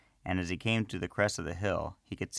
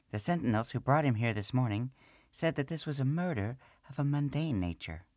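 high small gnome woman's voice, matter-of-fact; gnome priestess; 7 semitones higher than usual. Acted. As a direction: speak as the little priestess.

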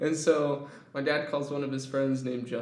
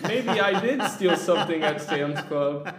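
laughing confession; 4 semitones higher, 6 dB louder than usual. Retold or imitated. Confused kid quote, laughing.

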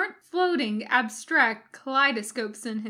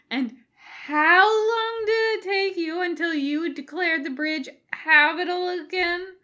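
high loud sing-song jab; pointed Jim; 8 semitones higher, 6 dB louder than usual. A narrator's reading. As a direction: make the point, needling.

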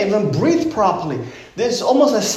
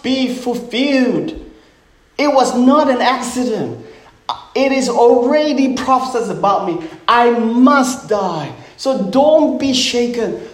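Jesus exhorting disciples; 5 semitones higher than usual. Acted. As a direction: draw out each phrase with insistent, preaching force. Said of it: "That's why Jesus said".

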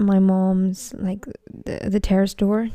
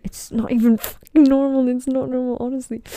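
higher, louder fed-up complaint; exasperated; 5 semitones higher, 4 dB louder than usual. Real, not acted. Complaining to listeners about mom's noise, exasperated. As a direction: vent sharply about the noise. Annoyed rant about loud mom.

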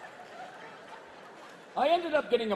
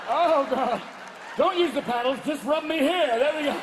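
higher, louder breathy plea; mock pleasured patient; 7 semitones higher, 6 dB louder than usual. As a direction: beg the doctor gently. Patient moaning to doctor.

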